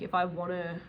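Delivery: ordinary delivery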